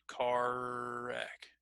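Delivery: CAR WRECK softly, with one long drawn-out R; stretched R. Shown as a demonstration. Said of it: "See how I can stretch that".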